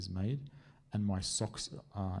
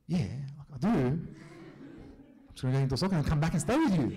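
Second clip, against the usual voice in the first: pleading; 5 semitones higher, 5 dB louder, faster than usual. Retold or imitated. Pleading with wife about soccer.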